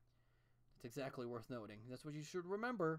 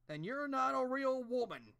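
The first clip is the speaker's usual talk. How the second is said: much higher, louder, slow sarcastic verdict; mocking quote; 10 semitones higher, 9 dB louder, slower than usual. Mocking the show's line to Sandra, slow sarcastic high voice. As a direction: drag it out as a ridiculous pronouncement. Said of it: "the fuck was that supposed to mean".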